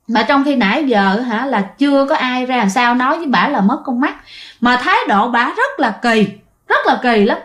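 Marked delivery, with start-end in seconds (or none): none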